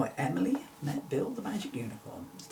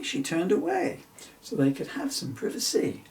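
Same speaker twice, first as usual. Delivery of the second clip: usual speaking voice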